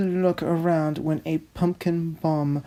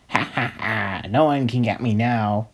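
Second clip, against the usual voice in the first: lower, gloating villain taunt; mock villain laugh; 5 semitones lower, faster than usual. The robber taunting the police, lower mock villain voice. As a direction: laugh it off like a cartoon crook.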